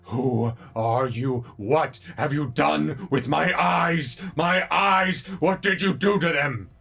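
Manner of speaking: roaring, anguished monster demanding answers; rumbling monster voice. Acted. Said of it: "you hear this deep rumbling voice".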